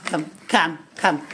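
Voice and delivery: ordinary delivery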